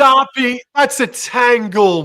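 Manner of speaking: high, slow outcry; co-host calling out to his mate; excited exclaim. Real, not acted. Reacting to a tangled ruck.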